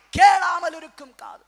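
emphatic repeat. Real, not acted. Preacher restating the heading to congregation, loud then easing. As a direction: start loud, then ease down. Second, less forceful take of heading.

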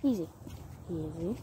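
soft, hushed coaxing; gently calm a frightened animal. Real, not acted. Soothing a scared horse.

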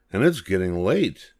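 ordinary delivery, nothing marked

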